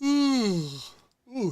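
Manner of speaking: loud high yawn; big open-mouthed yawn. Real, not acted. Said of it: "I do apologize for the yawning".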